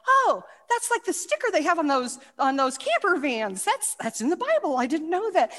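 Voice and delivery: high, loud, wide-eyed amazement; as if you just found out the sticker came from the Bible; amazed discovery. Retold or imitated. Recalling reading the Good Samaritan, bursting with excited amazement.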